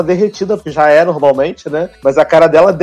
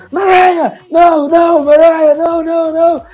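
gritos agudos de aflição; gritando; 9 semitones higher than usual. Retold or imitated. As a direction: grita pra Mariah parar, bem alto. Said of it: "tá todo mundo gritando". (todo mundo gritando pra Mariah parar)

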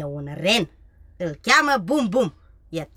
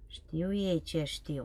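slow, secretive whisper; 6 semitones lower, 13 dB quieter, much slower than usual. Acted. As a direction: whisper so the birds don't hear. Secret about pretending pigeons. (șoaptă tainică și rară)

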